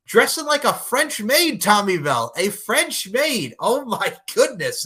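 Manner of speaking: high, slow amazed; amused disbelief. Real, not acted. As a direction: savour the outfit in disbelief. Amazed at French maid outfit.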